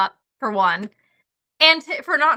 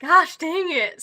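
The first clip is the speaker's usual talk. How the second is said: slow, drawn-out exasperated curse; mock frustration; 5 semitones higher, much slower than usual. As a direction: drag out the groan of defeat. Cub appears, frustrated groan.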